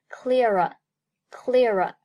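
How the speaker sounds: "clearer" said as "clear a", r carried into a; linked pronunciation. Shown as a demonstration. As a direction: slowly link "clearer" from "clear" plus "a". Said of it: "Clear和a连读".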